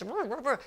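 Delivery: repeated grumbly growls under the breath; mock grumbling. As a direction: imitate a man muttering crossly to himself. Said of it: "he would be grumbling".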